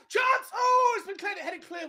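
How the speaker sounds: sudden high, loud shout at the chance; screaming commentary. Real, not acted. Fan yelling as the corner comes in, excited shout. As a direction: yell the chance, then the relief. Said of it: "Sorry, I scream".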